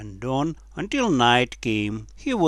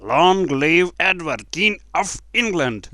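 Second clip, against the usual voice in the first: raised, loud acclamation; crowd shout; 5 semitones higher, 6 dB louder than usual. A narrator's reading. Drunken crowd hails the king, loud shouting. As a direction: shout it high and loud like a chant. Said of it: "Many voices began to shout".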